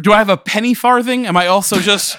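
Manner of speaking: high, loud sarcastic questioning; mock outrage. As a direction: rattle off absurd questions in playful indignation. Sarcastic questions about outdated gadgets.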